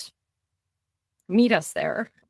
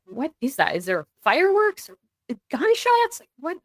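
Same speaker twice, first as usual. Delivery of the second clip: high-pitched, bewildered questioning; dazed confusion; 8 semitones higher than usual. Retold or imitated. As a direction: retell waking up confused by a strange noise. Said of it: "I'm kind of in a daze".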